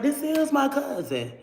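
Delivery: high, slow retold protest; imitating herself insisting to a jealous boyfriend; high insistent protest. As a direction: plead it high and drawn out.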